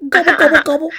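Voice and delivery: high, loud turkey gobble; silly high turkey call; gobbling like a turkey. Acted. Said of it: "I just gobbled like a turkey".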